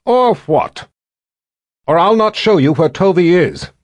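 put-on high, loud girl's bargain; defiant girl. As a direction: dare her, high and loud. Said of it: "Sister Alicia planted her fists".

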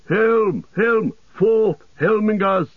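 high, ringing war call; rallying cry. A narrator's reading. Gamling rallying men of Westfold, high rallying cry. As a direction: summon the men with high, spaced calls. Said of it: "he cried".

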